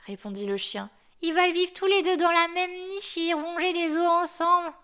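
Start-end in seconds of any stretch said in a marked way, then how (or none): 1.2-4.7 high, put-on voice for the old dog; the hoarse yard dog lecturing the newcomer; grumpy old guard dog. The chained dog explains sun and moon to the snowman, in a high put-on voice.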